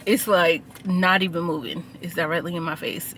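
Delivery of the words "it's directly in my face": ordinary talk, nothing marked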